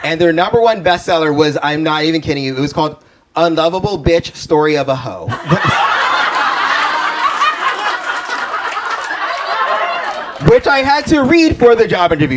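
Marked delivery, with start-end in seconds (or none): 5.3-10.4 breathy high-pitched cackle; high laugh. Real, not acted. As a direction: burst into a high airy laugh. Laughing at own story.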